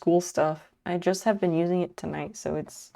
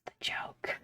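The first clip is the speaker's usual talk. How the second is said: whisper; 5 semitones lower, 14 dB quieter than usual. Real, not acted. Whispered joke aside.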